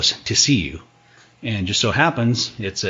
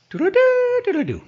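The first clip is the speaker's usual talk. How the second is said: silly high bugle. Shown as a demonstration. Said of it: "It's none of this, like".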